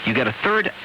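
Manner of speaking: normal talking voice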